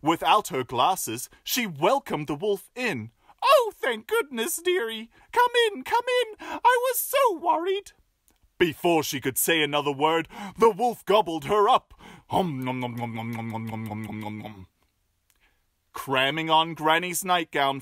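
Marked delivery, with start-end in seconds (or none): 3.4-7.9 very high quavery grandma; old granny voice. Acted. Grandma welcoming the wolf, high and fussy. 12.3-14.7 fading munching noms; chomping noms. Acted. Wolf eating Grandma, munching softly.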